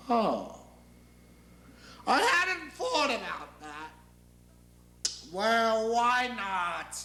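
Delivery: high, ranting deity; from caught out to gleeful indignation; God. Acted. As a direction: stretch it out with pompous relish. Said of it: "because I'm God".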